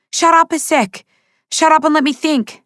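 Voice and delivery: high, loud, frantic shut-up; panicked snapping. A narrator's reading. Kieran snaps at Zoe to let him think, high and frantic. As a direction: shove her words away, desperate to think.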